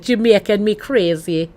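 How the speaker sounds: put-on a light, jokey Jamaican accent on the drink name; playful mock Jamaican lilt. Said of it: "it's the worst Jamaican accent you could possibly imagine".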